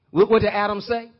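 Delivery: normal talking voice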